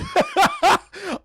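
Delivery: a loud, very high burst of laughter. Real, not acted. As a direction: howl with real laughter. Cracking up at the image.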